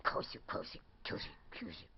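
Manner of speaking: hurried whispered plea; urgent whisper. Pretend. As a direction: hiss it under your breath to shut the door.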